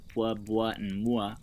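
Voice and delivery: "pwa," "bwa," "mwa" pronounced slowly with rounded lips; "bwa" and "mwa" voiced slow and deliberate, faintly disgusted; sounding out syllables. Shown as a demonstration. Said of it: "are ugly".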